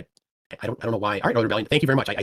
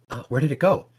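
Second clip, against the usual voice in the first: ordinary talk, nothing marked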